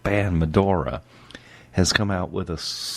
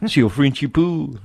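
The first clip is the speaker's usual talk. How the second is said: high, silly fake French; mock French accent; 7 semitones higher than usual. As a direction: ham up a jokey French accent. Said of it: "However you say that in French".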